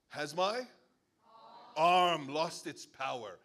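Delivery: loud, slow, weighty divine question; booming God. Retold or imitated. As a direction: thunder it out as God. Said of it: "the Lord said to Moses".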